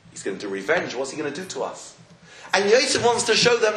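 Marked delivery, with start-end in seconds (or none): 0.1-1.9 low, quiet worried asking; hushed fear. Retold or imitated. Shocked brothers fearing revenge, hushed retold fear. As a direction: voice the dread under your breath. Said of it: "In shock and fear".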